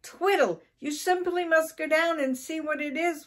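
high, voiced stage whisper; urgent mock whisper. A narrator's reading. Frightened wife sends husband downstairs, high urgent mock whisper. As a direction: press him urgently, pitched high. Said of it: "whispered Missus Twiddle".

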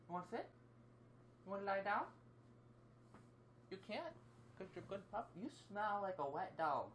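high-pitched pet talk; talking to a dog. Real, not acted. Talking to the pup, high doting voice.

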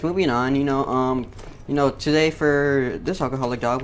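usual speaking voice

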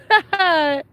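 high bubbling laugh; amused laugh. Real, not acted. Owner watching the donkey hop about, amused high laugh. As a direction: laugh out in delight.

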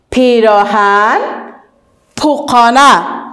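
پیراهن and پوکانه spoken slowly, syllable by syllable; slow model words. Shown as a demonstration. Teacher repeating example words slowly for the class. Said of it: "ببینین".

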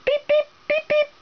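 very high squeaky chick peeps; chick peeping. Pretend. Mother playing a chick for her toddler, high squeaky peeps. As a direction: squeak like a tiny baby chick. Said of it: "I can be a chick and say".

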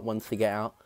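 lips pulled apart by fingers; talking while removing retainer; fingers in mouth. Real, not acted. Seen 0: Both hands' fingers hook lower retainer inside mouth throughout labelled words.

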